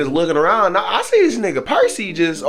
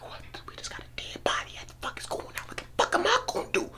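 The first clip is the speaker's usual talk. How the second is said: frantic whisper, much softer, rushing x1.5; panicked whisper; 15 dB quieter, faster than usual. Retold or imitated. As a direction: whisper fast, scared of being caught. Panicking that Percy is dead.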